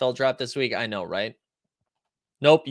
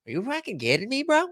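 put-on higher, heavier Boston accent; full Boston accent, pitched higher; 11 semitones higher than usual. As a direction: push the Boston accent harder on the repeat. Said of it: "That's Boston".

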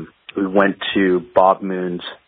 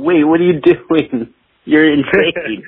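high, slow incredulous "wait, what"; mock shock at a crazy edit; disbelieving; 8 semitones higher, 4 dB louder, slower than usual. Young guitarist to engineer, high incredulous disbelief. Retelling his own disbelief at Steve.

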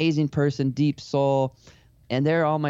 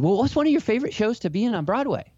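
nervous chirpy question; 10 semitones higher, faster than usual. Retold or imitated. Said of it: "so uncomfortable with him crying".

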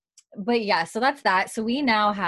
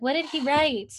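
impatient eagerness; 7 semitones higher than usual. Retold or imitated. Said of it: "You're like".

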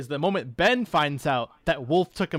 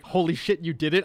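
ordinary talk

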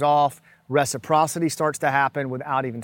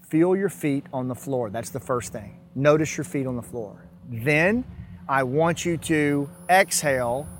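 calm grounding exercise. Shown as a demonstration. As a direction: talk them calmly through the breaths. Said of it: "guides a breathing exercise".

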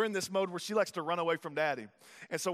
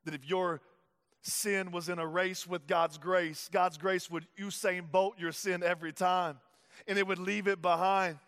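usual speaking voice

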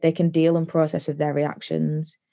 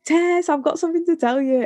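high, singsong call to Tess; 12 semitones higher than usual. Retold or imitated. Calling her stepmom Tess in to hear gossip, high and eager.

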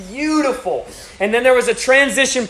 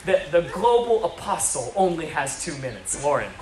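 Joy Dawson; 4 semitones lower, 6 dB quieter than usual. Retold or imitated. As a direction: imitate an affronted elder laying down each word. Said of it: "she is livid".

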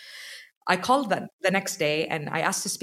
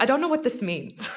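retold bewilderment; 7 semitones higher than usual. Retold bewilderment at huge bill.

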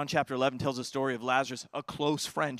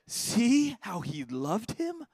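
slow, high, marvelling; awed wonder; 5 semitones higher, much slower than usual. Acted. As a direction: draw out the wonder of it slowly.

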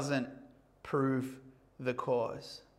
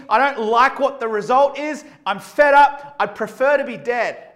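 higher and louder, fed-up ranting; angry Jonah; 3 semitones higher, 8 dB louder than usual. Retold or imitated. Jonah complaining to God, in a fed-up rant. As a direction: vent at God like a sulking prophet. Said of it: "Jonah's just said".

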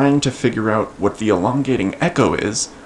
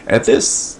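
ordinary delivery

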